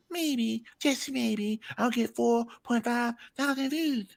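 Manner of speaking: imitating Battle Truth. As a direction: play the jealous rival plotting. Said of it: "The anger in a motherfucker".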